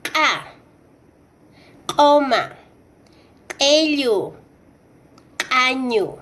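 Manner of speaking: "ka", "Koma", "Kellu", "Kañu" as glottal q', cut off before the vowel; ejective Q. Shown as a demonstration. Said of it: "último fonema de este grupo".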